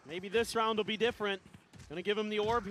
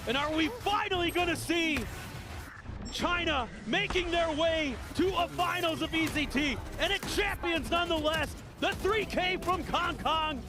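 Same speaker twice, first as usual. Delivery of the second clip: excited caster; 8 semitones higher than usual. Real, not acted. Co-caster hyping the play.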